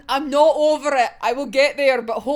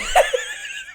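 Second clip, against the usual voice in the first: very high squealing laugh; a squeaky high giggle fit; shrieking laugh. Real, not acted. Laughs at own thought.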